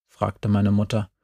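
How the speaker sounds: ordinary delivery, nothing marked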